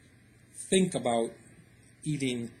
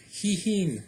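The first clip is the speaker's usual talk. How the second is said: imitated horse neigh; 6 semitones higher than usual. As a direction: give hihin as a horse's whinny, up high. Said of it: "he does that, you know, neighing thing".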